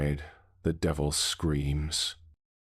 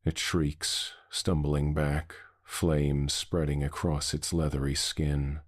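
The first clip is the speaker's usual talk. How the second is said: ordinary delivery, nothing marked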